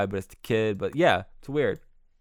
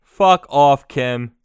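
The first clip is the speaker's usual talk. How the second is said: loud dismissive yell; 3 semitones higher, 13 dB louder, much slower than usual. Acted. Host dismisses hacker.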